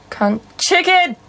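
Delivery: high, abrupt outburst; sudden vocal tic. Real, not acted. Streamer's involuntary tic in a pause, high sudden blurt. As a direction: blurt it out, jumping high on the last word.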